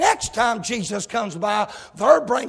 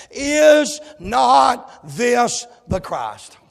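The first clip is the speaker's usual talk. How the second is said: drawn-out proclaiming shout; woman at the well proclaiming to the city, loud and slow; slow shouted question; 3 semitones higher, 8 dB louder, much slower than usual. Acted. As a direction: cry it out slowly like a revelation. Preacher voicing the woman's cry.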